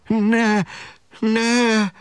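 tiny high-pitched squeal of protest; feeble squeal. A narrator's reading. Szemüveg protesting in the giant's hand, feeble high squeal. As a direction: squeak it out, thin and helpless. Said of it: "de hiába visított".

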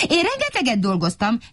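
high protesting voice; mild mock yell; mock shouting. A narrator's reading. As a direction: insist in a high little voice. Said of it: "kiabált megint Vacska Matti".

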